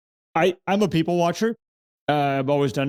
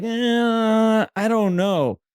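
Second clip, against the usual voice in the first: higher, hesitant mock shrug; mock reluctant eh; 7 semitones higher, much slower than usual. Imitating anyone unsure when an opportunity comes, higher doubtful shrug. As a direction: hem and haw like someone balking at the chance. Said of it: "it's like".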